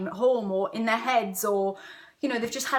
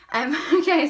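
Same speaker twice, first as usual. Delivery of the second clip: laughing; 5 semitones higher, 6 dB louder than usual. Real, not acted. Laughs at own breathlessness.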